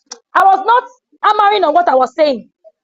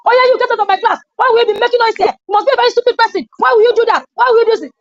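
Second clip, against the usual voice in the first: scolding a noisy pupil, pitched up; aggressive scolding; 6 semitones higher, faster than usual. Shown as a demonstration. Teacher scolding a noisy student, angry and pitched up. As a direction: snap at the class like an angry teacher. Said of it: "What's wrong with my tone".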